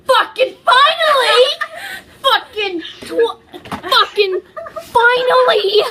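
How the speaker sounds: loud, laughing cry of relief; relieved shout. Real, not acted. Cheering the end of the fanfic reading, loud and laughing. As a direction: yell it out, glad it's over. Story ends, relieved outburst.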